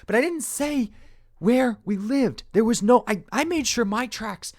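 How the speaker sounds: high, loud, pleading outburst; distraught protest. Acted. Character defending themselves to their accuser, high distraught protest. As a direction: protest your innocence, voice shooting up.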